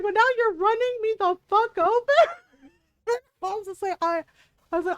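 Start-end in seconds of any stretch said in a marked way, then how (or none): 0.0-2.3 high, loud outraged yell; mock angry yell. Gamer imitating her run-over partner, mock outraged yell. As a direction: shout it as the run-over victim. Husband character yelling in retold scene.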